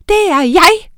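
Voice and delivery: loud, high indignant shout; shouted together. Acted. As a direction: yell it, both insisting. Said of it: "råbte de begge to". (høje, indignerede råb)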